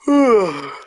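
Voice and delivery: long audible yawn, voice rising; big yawn. Real, not acted. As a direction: yawn out loud mid-sentence. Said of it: "Sorry, yawn".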